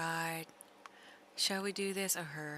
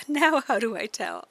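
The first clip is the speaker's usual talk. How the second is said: mock panic; 4 semitones higher, 12 dB louder than usual. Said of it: "'Cause I would be like".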